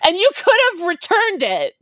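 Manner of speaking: high, loud comeback; sharp retort. Retold or imitated. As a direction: snap it right back. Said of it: "I was like".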